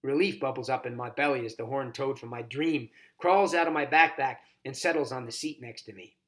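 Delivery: ordinary delivery, nothing marked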